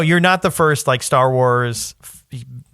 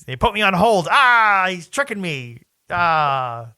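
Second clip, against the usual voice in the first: lightly mocked, higher-pitched villain outburst; hammed-up frustrated cry; exasperated villain; 4 semitones higher, slower than usual. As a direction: play a pranked general crying out on hold. Mocking the pranked general's cries.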